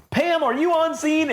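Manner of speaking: high-pitched handover to a field reporter; news anchor. Acted. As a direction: call to the reporter on scene. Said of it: "then you just see on the news".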